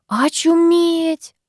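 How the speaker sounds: high, long-drawn stunned exclamation; stunned murmur. A narrator's reading. Friend reacting to a fountain-drowning story, stunned. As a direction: draw it out slowly, high in disbelief. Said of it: "пробормотала Викуля".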